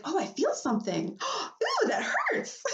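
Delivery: hammed-up woman in labor feeling a contraction; mock labor pain. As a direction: pitch shoots far up, gasping ouch. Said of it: "there's a point where you're like".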